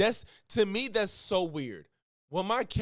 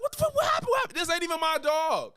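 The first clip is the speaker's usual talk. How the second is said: much higher, frantic alarm; panicked shriek; 12 semitones higher, faster than usual. Retold or imitated. Retelling the sister's dog getting its paw caught, high panicked shriek. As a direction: squeal it as if the dog just screamed. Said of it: "I'm like".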